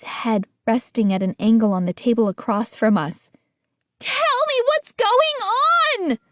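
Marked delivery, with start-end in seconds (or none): 4.0-6.2 high, slow, strained cry; scream. A narrator's reading. Son demanding answers from Mom, high desperate scream. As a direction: demand it at the top of the voice. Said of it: "I screamed".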